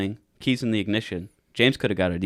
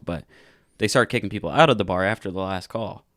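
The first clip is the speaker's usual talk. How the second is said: normal talking voice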